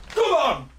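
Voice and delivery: high, loud come on; fired-up yell. Real, not acted. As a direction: roar it out to the team. Celebrating penalty.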